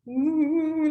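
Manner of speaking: ghostly ooh, drawn out; ghost-style spooky ooh. Retold or imitated. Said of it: "they can't even help going".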